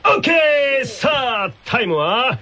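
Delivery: upbeat announcer hyping the contest; game show host. Acted. Hosting a tongue-twister contest for the radio audience, as an upbeat announcer. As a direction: call it like a TV quiz host over music.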